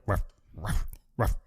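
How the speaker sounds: soft imitated dog barks; dog bark impression. Friend imitating Baxter the dog, soft barks. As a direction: bark quietly like the little dog. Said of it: "it'll just, like, be like".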